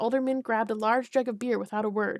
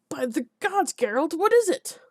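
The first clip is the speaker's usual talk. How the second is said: shocked gasp; 6 semitones higher, 5 dB louder than usual. Acted. Alderman shocked by monster.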